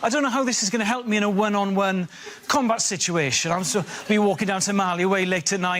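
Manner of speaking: ordinary delivery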